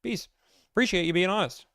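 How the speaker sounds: high, breezy fake-polite goodbye; bright mock farewell; sarcastic brush-off. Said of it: "I'm like".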